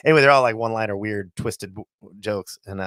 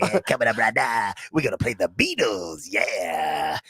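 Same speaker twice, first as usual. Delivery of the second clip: Wolfman Jack; 4 semitones lower, 3 dB quieter, slower than usual. Acted. DJ to listeners before the [name], Wolfman Jack voice. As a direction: lower seventies radio DJ voice, words stretched. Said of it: "That's how he sounded".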